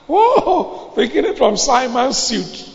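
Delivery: high whoop then slow laughing line; laughing exclaim. Real, not acted. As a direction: crack up at the joke. Laughing at own joke.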